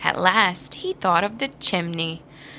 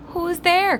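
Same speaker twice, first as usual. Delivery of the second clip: high, loud call; 10 semitones higher, 4 dB louder than usual. A narrator's reading. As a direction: call through the door. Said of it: "called the second little pig".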